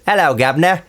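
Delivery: hammed-up Cockney greeting, pitched high. Shown as a demonstration. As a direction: put on a stock Cockney accent. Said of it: "That was a stereotypical Cockney accent".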